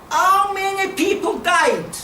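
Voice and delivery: loud, slow, pained question; emotional outcry. Real, not acted. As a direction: let the grief push the words out slowly. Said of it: "I get a bit emotional".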